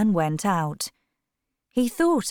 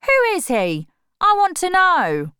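much higher, louder demand; angry demand; 12 semitones higher, 6 dB louder than usual. A narrator's reading. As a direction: insist on knowing.

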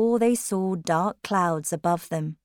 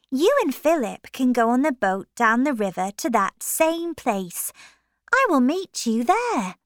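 lightly voiced woman; 6 semitones higher, 4 dB louder than usual. Lucy explains plan.

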